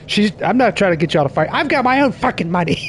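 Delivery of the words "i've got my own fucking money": high put-on sassy voice; hammed-up girlfriend comeback; mock lady voice. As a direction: play the sassy girlfriend, lightly.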